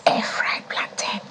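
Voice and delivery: very soft whispered prompt; whispering. Real, not acted. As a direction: whisper it to the guest as a reminder. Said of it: "Why are you whispering to them?".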